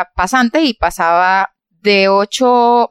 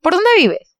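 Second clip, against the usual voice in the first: higher, sing-song manager voice; imitating the manager; 9 semitones higher, slower than usual. Manager asking Giselita for a car, higher sweet voice. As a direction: ask it sweetly in her voice.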